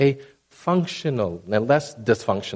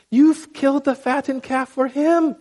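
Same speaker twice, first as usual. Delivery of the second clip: high, slow, whining complaint; aggrieved son protesting to his dad, high and whiny; indignant older son; 13 semitones higher, 3 dB louder, slower than usual. Retold or imitated. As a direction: whine it out, pitched up high and drawn long. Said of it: "this is the older son".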